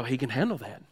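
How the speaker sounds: very low, hushed answer; gentle, matter-of-fact reassurance; nice and calm. Retold or imitated. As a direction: drop the voice right down, soft and unruffled. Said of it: "tell them in my nice way".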